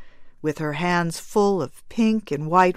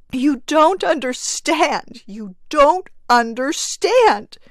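high, loud, slow desperate repeat; wild cry; 9 semitones higher, 7 dB louder, slower than usual. Acted. Mother refusing her husband, loud and desperate. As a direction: hammer the words out in despair. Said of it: "cried the woman wildly".

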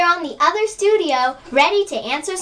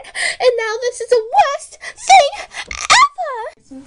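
dramatic wail; 9 semitones higher, slower than usual. Acted. Rarity's melodramatic wail.

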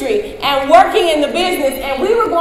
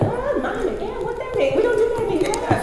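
high-pitched, softer mock bewilderment; baffled reaction; 7 semitones higher, 5 dB quieter, faster than usual. Traveller reacting to the British announcement, comically baffled. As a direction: play up the puzzled American tourist, pitched high.

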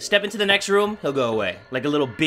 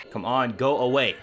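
ordinary delivery

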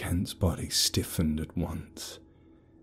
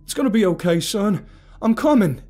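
high, loud urgent comfort; reassuring shout; 13 semitones higher, 8 dB louder than usual. A narrator's reading. Father climbing in to reach his son, urgent shout. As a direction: promise him you're on your way.

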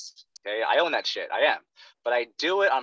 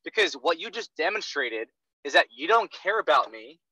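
ordinary talk

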